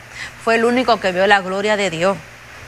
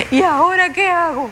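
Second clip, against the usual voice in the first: higher, put-on helpless voice; 6 semitones higher than usual. Preacher repeats the line, changed.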